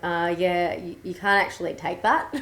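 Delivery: put-on strong Aussie accent; broad Australian accent. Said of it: "I'm talking in a very strong Australian accent".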